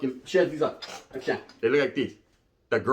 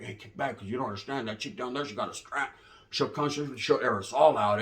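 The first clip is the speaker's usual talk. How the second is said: much softer, low warning; hushed warning; 6 semitones lower, 9 dB quieter than usual. Retold or imitated. Warning the vato quietly about the armed girl. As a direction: warn him under your breath.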